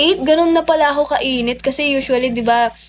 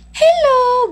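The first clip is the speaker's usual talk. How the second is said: much higher, bright mock hello; imitating his eager hello; 14 semitones higher, 3 dB louder than usual. Boyfriend finally hears her answer, high eager hello. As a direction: chirp it back like an excited boyfriend. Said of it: "Gumanon siya sa akin".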